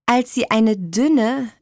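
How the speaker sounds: ordinary delivery, nothing marked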